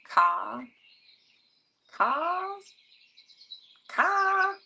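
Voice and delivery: drawn-out, rising East Coast 'car'; East Coast accent. Pretend. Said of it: "saying car in, like, a East Coast accent".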